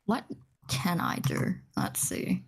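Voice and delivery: normal talking voice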